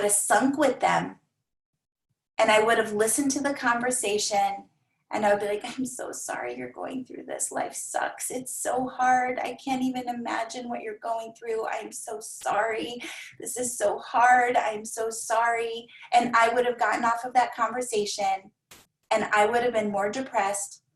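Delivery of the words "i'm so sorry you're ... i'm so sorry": pitying commiseration. Retold or imitated. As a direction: sink into their misery with them, softly. Old self pitying a client.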